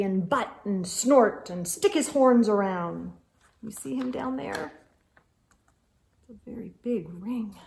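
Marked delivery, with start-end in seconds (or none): 3.6-7.6 much softer, quick aside; quiet aside. Real, not acted. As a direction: tuck it in under the voice. Soft aside about picture.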